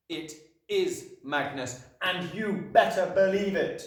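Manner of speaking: slow, deliberate, building to a loud warning; Magnus's written voice. A narrator's reading. Chalk answering the headmistress, slow and commanding. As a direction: insist weightily, then push harder. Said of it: "it said on the board".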